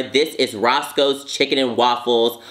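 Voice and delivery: ordinary talk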